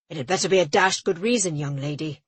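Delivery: put-on a pompous official lecturing a young woman; condescending scold. A narrator's reading. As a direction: talk down to a girl.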